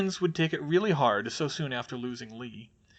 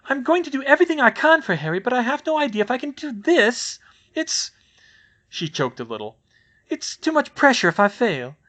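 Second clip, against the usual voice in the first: overwhelmed; 10 semitones higher, 7 dB louder than usual. Acted. Overwhelmed, about to cry.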